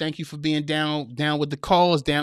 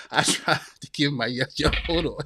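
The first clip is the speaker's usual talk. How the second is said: breaking into laughter; 2 semitones lower than usual. Real, not acted. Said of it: "read this without laughing".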